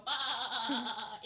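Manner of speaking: loud sheep-like cartoon laugh; mock bleating cackle; random bleat. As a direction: break out in a sudden bleat. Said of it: "I should just randomly go".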